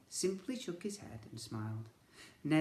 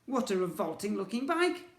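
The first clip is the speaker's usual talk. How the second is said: dismissive; 8 semitones higher, 7 dB louder than usual. Acted. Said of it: "said Edmund dismissively".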